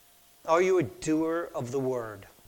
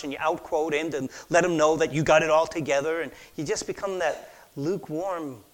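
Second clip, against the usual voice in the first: ordinary talk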